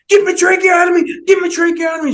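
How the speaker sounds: high, loud shouted command; shouted order. Retold or imitated. ICU head shouting at the surgeon to operate, high shout. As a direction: shout it at him, pointing your finger. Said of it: "And she went".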